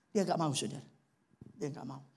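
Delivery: normal talking voice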